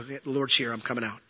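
low, soft and fading; quiet realisation. Acted. Elijah recognising the Lord's voice, quietly. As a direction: say it softly, almost to yourself. Elijah hears the still small voice.

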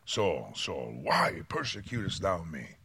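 low, soft voice of God calling; voice from heaven. Acted. God speaking to Saul on the road, low and soft. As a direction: call his name slowly from above. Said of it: "heard a voice saying unto him".

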